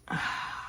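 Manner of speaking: soft exhale; weary little sigh; irritated sigh. Retold or imitated. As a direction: quietly let out a frustrated breath. Irritated pause in the story.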